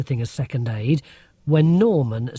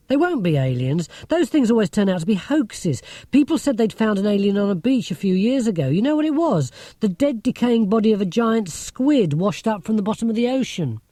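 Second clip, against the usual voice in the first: put-on higher, lively, lecturing girl's voice; Marcia, know-it-all girl; 7 semitones higher than usual. A narrator's reading. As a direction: play a clever, sceptical schoolgirl.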